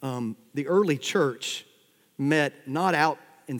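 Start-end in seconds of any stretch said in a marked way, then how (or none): none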